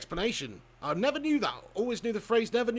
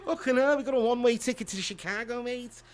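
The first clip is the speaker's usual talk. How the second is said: higher-pitched mock Liverpool accent; Scouse accent; 6 semitones higher than usual. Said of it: "it sound like a Scouser".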